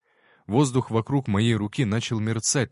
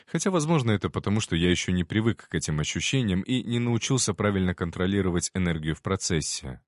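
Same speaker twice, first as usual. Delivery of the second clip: ordinary delivery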